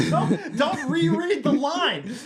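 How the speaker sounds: high, slow laughing words; laughing plea. Real, not acted. Co-host begging through laughter, high and slow. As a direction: beg through giggles.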